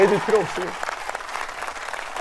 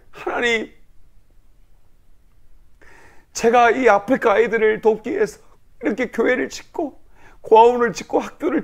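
retold tearful prayer; 3 semitones higher than usual. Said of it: "그렇게 기도했어요".

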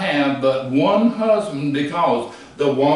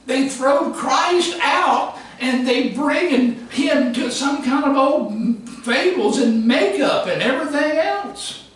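preaching at full force; 7 semitones higher than usual. Acted. Preacher to congregation, pressing the point in a high, ringing preach. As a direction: hammer the point home to the congregation.

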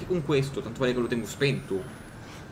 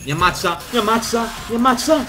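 rising terrified shriek, louder each time; panicked screaming; 10 semitones higher, 8 dB louder than usual. Real, not acted. Panico davanti al mostro. (urla di panico crescente)

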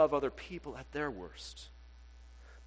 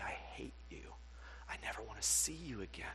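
breathy whispered cruelty, barely voiced; hushed whisper; 6 semitones lower, 10 dB quieter than usual. Whispering a spouse's cruel words to the congregation. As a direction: whisper it like it still wounds. Spouse saying I hate you.

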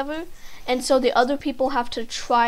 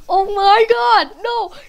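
high, loud, drawn-out outcry; real dismayed shout; 9 semitones higher, 11 dB louder, slower than usual. Real, not acted. Player losing badly in the game, dismayed shout. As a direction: yell it in gamer despair.

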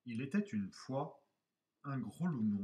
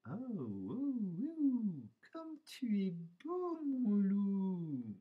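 put-on high voice, hushed and smitten; murmuring she-wolves; 7 semitones higher, slower than usual. A narrator's reading. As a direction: coo admiringly in the wolf's ear. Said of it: "en murmurant à ses oreilles".

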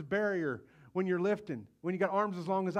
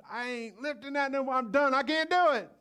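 louder, rising mock quitting voice; devil's taunt; 5 semitones higher, 4 dB louder than usual. Preacher voicing devil's whisper to quit, mildly raised mock voice. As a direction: voice the temptation to quit. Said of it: "he comes to tell us".

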